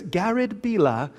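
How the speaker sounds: "Bila" in a British accent, final r dropped; British pronunciation. Shown as a demonstration. Husband mimicking his British wife saying "Bila", British accent. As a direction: say "Bila" the British way, ending in an open "ah". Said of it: "my wife's British".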